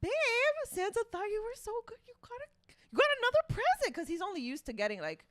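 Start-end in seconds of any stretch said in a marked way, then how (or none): 0.0-3.9 excited. Retold or imitated. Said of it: "So I'm like".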